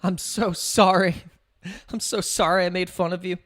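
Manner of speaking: high, pleading mock apology; hammed-up relative begging forgiveness; mock panicked relative. Relative at Christmas apologizing for mocking crypto, pleading. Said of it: "they're gonna be like".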